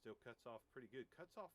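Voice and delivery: very faint, away from the microphone; off-mic. Shown as a demonstration. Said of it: "go ninety degrees off axis".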